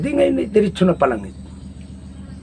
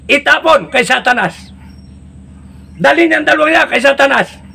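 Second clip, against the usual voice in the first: high-pitched angry Saint Peter; scolding Saint Peter; 8 semitones higher than usual. Acted.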